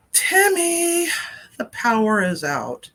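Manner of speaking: high drawn-out name, then dropping; mock exasperated holler; retold insistent call. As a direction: stretch the name to get attention. Retold second call, getting no response.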